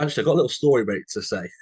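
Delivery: normal talking voice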